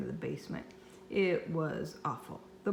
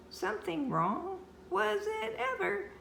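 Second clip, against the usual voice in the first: incredulous. A narrator's reading. Boy's incredulous thought.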